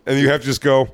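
ordinary talk

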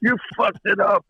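high-pitched mock complaint; comic high-voiced protest; outraged fans. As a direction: imitate an indignant fan, voice jumping way up. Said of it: "letters from fans saying".

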